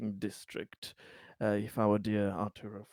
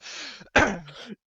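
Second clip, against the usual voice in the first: laughing; 11 semitones higher, 7 dB louder than usual. Real, not acted. Laughs mid-sentence at Presidento.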